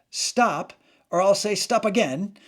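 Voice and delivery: Monty Python police skit. Retold or imitated. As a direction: call it out like a sketch-comedy policeman. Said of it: "I know it was a terrible accent".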